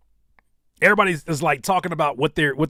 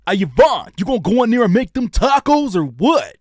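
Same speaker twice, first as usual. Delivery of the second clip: loud, higher, brash dubbed-movie voice; movie voiceover impression; 7 semitones higher, 7 dB louder than usual. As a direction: belt it like a TV-edit dub. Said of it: "the voiceovers for the BET version".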